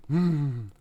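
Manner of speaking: slow, low, sleepy monkey; drawn-out low murmur; Master Turtle voice. Retold or imitated. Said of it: "like, clip from the Kung Fu Panda show".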